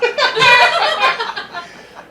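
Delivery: high loud laugh; laughing fit. Real, not acted. Comedian cracking up at his hiking story. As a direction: crack up mid-sentence.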